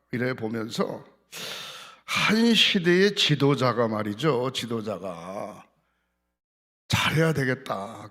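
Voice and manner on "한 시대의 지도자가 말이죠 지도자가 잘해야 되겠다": very slow, fading repetition; slow right down and let it sink in; solemn emphasis. Real, not acted. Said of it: "slow solemn emphasis".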